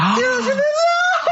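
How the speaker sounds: a huge high mock-shocked gasp. To Carl after his jibe, a mock-scandalised gasp. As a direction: gasp as if deeply offended.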